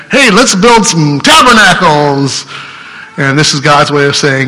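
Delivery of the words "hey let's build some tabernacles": a slow, eager blurt as naive Peter. Retold or imitated. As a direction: play Peter talking out of turn.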